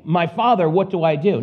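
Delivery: ordinary delivery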